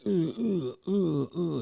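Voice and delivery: loud, high "ooh" on each hit; imitated punching grunts. Guy goes back to the bag, imitated loud grunts.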